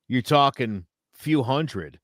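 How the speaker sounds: usual speaking voice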